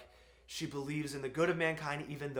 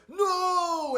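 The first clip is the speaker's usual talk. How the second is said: loud, high, grief-struck cry of no; anguished scream; 13 semitones higher, 9 dB louder than usual. Retold or imitated. Retelling the film, Wonder Woman screaming as he dies. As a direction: scream it as she watches him die. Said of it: "and she's like".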